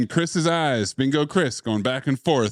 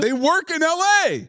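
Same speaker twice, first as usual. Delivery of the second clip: mock villain; 13 semitones higher than usual. Mocking the villain line.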